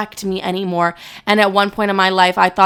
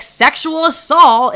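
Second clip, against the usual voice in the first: higher, louder, drawn out slowly; mock screaming; 7 semitones higher, 5 dB louder, much slower than usual. Mocking how screaming it on TikTok would sound, hammed-up yell. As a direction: blare it like an over-the-top TikTok shout. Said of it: "just, like, screaming it on TikTok".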